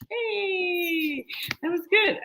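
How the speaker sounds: high, excited shout of praise; excited cheer. Real, not acted. Cheering the joke.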